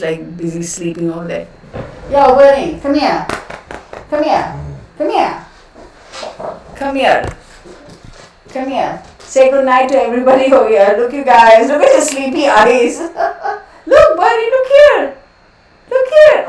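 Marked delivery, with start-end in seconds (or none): 2.1-9.0 higher, coaxing call to a pet; calling the dog. Real, not acted. Owner coaxing her sleepy dog to come, calling. 11.7-16.4 very high, loud pet talk. Real, not acted. Owner baby-talking her sleepy dog, very high pet voice.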